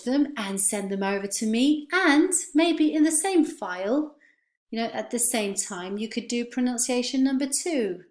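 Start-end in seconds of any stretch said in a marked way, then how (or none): none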